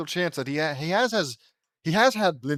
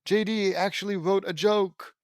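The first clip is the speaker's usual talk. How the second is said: mock amazement; 4 semitones higher, slower than usual. Reader mocking praise for J D's joke, mock amazement. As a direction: fake being thrilled, ending on a stretched cheer. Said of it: "you know how it was all like".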